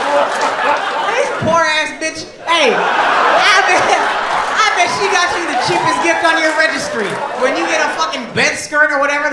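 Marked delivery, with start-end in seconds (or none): 0.1-6.2 slow, louder roast through laughter; laughing shout. Real, not acted. Comic roasts the wife who yelled from the cheap seats, laughing shout. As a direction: crack up while roasting.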